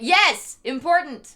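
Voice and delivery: loud, high burst of agreement; excited yes. Real, not acted. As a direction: shout it out to chat happily. Streamer answers chat, excited.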